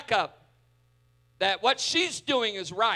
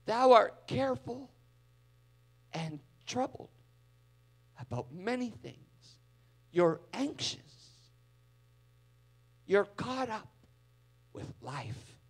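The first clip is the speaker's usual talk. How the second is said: loving tone; 4 dB quieter than usual. Shown as a demonstration. Jesus speaking lovingly to the overwhelmed Martha, soft and tender. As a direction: gently stop her in her tracks. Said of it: "you can hear the love in his tone".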